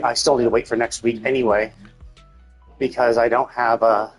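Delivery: usual speaking voice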